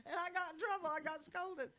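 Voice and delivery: high, loud comic grievance; mock complaint. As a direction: lament it high and loud, half laughing. Laughingly protesting her scolding.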